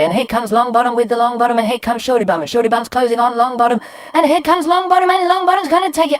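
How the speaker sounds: fast, high, loud horse-race call building in excitement; breathless commentator nearing the finish; racing commentary. Acted. Boy commentating on drips racing down mirror, excited race call. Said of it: "start doing a running commentary".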